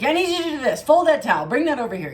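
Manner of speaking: loud, high barked grandma orders; high, loud commanding grandma; bossy grandma. Retold or imitated. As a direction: yell chores like an Italian grandmother. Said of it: "she'd be like".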